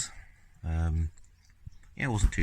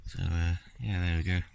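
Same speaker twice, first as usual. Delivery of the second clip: ordinary talk, nothing marked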